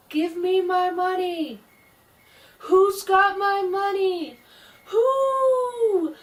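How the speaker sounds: very high, slow, wailing ghost voice; ghost crying. Acted. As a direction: wail from far off like a lost spirit. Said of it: "the voice still was crying".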